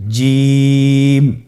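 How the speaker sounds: জিম sung long and held on the vowel; drawn-out Jim. Shown as a demonstration. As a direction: stretch জিম over three alifs. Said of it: "আপনারা আমার সাথে বলুন". (টেনে লম্বা করে জিম)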